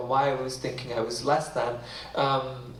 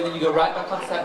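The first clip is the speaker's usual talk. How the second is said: ordinary talk, nothing marked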